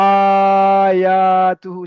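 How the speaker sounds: আয়াতুহু drawn out long and loud, sung evenly; stretched recitation. Shown as a demonstration. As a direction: hold each vowel of আয়াতুহু. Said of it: "শেষে গিয়ে টানটা করে দিতে পারি". (আয়াতুহু টেনে উঁচু গলায় সুর করে)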